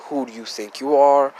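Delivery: ordinary delivery, nothing marked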